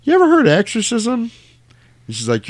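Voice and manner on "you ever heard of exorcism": high, loud mock-ominous doctor question; doctor tells the mother about exorcism in a high, hammed-up voice; hushed doctor suggestion. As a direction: pitch it like a spooky reveal.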